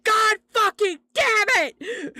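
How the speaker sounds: high, loud, drawn-out re-enacted scream; full-force retold yell of frustration. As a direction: scream it out word by word. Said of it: "just screaming".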